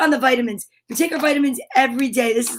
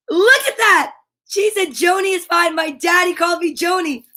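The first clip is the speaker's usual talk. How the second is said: high-pitched, thrilled reading; delighted surprise; 5 semitones higher than usual. Real, not acted. Host reading a viewer's sweet reply, high and excited. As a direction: read out the message with real delight.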